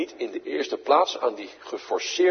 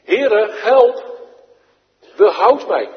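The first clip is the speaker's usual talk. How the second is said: loud, high plea; shown cry for help; 8 semitones higher, 10 dB louder, slower than usual. Shown as a demonstration. Preacher showing the children how to call, loud and high. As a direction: cry out to the Lord, loud and urgent. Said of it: "Nou zo".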